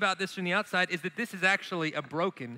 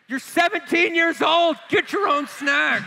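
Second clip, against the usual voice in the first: loud, high, slow dad retort with a laugh; exasperated dad; 9 semitones higher, 7 dB louder, slower than usual. Retold or imitated. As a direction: bark it back at the lazy teenager, half laughing. Dad snapping at teen son.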